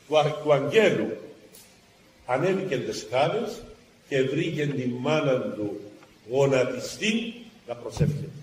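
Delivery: normal talking voice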